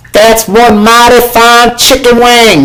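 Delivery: high, slow showman voice; hammed-up announcer. Showing the breaded chicken to viewers, a hammed-up showman. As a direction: present the wing like a proud host.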